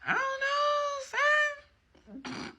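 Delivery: very high, very slow, drawn-out puzzled deity; voice of the divine. Retold or imitated. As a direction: stretch it out high and otherworldly, like a baffled god. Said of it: "the divine went over it a couple times, like".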